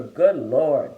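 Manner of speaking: usual speaking voice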